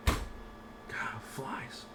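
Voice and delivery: whispered annoyed aside. Real, not acted. Teacher annoyed by a fly, whispering. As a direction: whisper your frustration at the fly.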